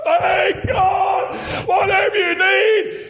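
high, drawn-out shouts of praise; full-force preaching. Real, not acted. Preacher proclaiming God's answer to the congregation, exultant shouting. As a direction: cry it out high and long to the church.

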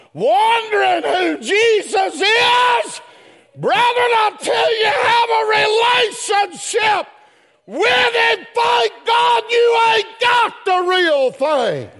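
high, shouted gospel preaching; full-force preaching. Real, not acted. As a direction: belt it out to the whole church.

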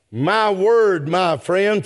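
normal talking voice

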